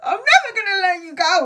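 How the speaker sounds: high, very fast mock wail; cling to her, voice up high; clingy. Clinging to dead friend, retold.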